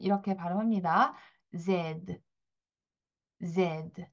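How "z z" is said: Z with the throat buzzing, soft and low; Z zède, quiet and vibrating; voiced French Z. Shown as a demonstration. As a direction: buzz Z gently from the throat. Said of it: "목청을 살짝 울리면서 이렇게 떨리는".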